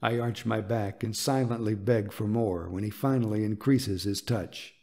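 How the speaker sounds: usual speaking voice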